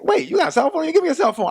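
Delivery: high, incredulous friend voice; imitating a stunned buddy; amazed. Friend amazed at cell phone.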